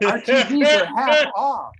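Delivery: a hint of a loud salesman; store clerk. Appliance store clerk announcing a sale, lightly voiced. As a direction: call it out like a sales pitch.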